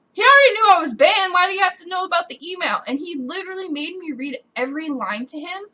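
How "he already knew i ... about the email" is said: loud, high, exasperated; worked-up venting; indignant rant. Real, not acted. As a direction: protest it in raised pitch. Indignant rant about the agent.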